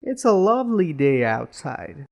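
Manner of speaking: cheerful, lilting demonstration; happy. Shown as a demonstration. Teacher showing students how tone conveys feeling, happy. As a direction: say it as if pleased about it. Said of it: "if you're happy about it".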